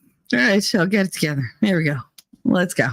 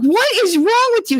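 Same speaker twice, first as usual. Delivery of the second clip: sharp, high, louder demand; annoyed wife; 9 semitones higher, 3 dB louder than usual. Retold or imitated. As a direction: snap at him in disbelief. Wife snaps at husband mid-sex.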